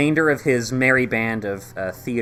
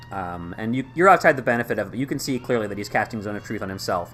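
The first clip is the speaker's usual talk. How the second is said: usual speaking voice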